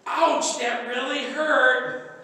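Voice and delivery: drawn-out hammed ouch; mock ouch. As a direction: ham up a fake sting from scripture. Mock reaction.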